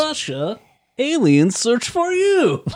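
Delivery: high, slow hammed-up Russian joke voice; Russian accent. Cohost topping the joke in a high, slow mock Russian accent. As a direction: ham up the old Soviet Russia gag. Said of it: "Calm down, Yakov Smirnoff".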